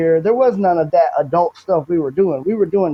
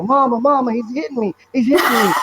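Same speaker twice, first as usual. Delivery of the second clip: mock child's yell; 6 semitones higher than usual. Younger sibling calling Mama for help, mock yell. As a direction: imitate a kid calling out for Mama. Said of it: "I'd yell".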